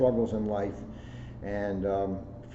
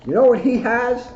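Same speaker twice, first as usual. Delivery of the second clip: eager teaser; 14 semitones higher, 11 dB louder than usual. Real, not acted. Building up the book's ending.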